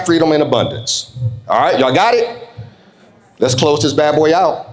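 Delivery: normal talking voice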